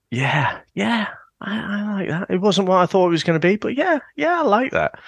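high, strained yeah yeah I like that; convincing myself. Retold or imitated. Fan leaving the cinema, high forced self-persuasion. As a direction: talk yourself into liking it, forced brightness. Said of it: "convincing myself at the end of it".

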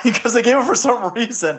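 ordinary talk

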